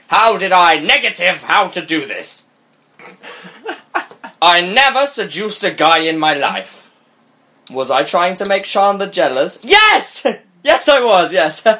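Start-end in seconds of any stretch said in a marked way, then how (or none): none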